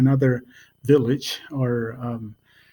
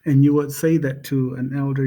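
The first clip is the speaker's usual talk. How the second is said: ordinary talk, nothing marked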